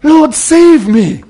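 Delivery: slow, pleading loud cry. Acted. Sinking Peter cries to Jesus, slow loud plea. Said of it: "he cried".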